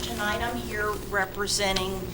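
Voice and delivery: ordinary delivery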